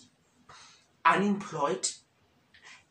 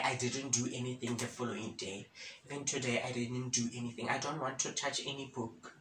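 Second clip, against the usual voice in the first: drained and quiet; 5 semitones lower, 9 dB quieter, faster than usual. Real, not acted. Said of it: "face the repercussions".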